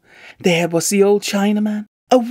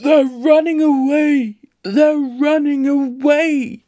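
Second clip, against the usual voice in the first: raised, alarmed cry, slowing on repeat; shouting; 5 semitones higher, slower than usual. A narrator's reading. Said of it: "cried out".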